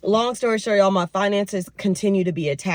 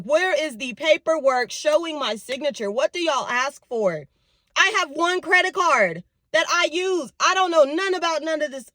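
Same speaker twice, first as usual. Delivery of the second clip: high, insistent protest, retold; upset retort; 7 semitones higher than usual. Daughter retelling how she challenged the card callers, upset. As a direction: demand answers in a raised pitch. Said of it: "Oh, I was upset".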